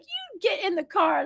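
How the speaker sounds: high-pitched mock order; upset scolding, imitated. Minder ordering kids into the car, high upset voice. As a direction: lightly act a cross minder's command. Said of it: "she was really upset".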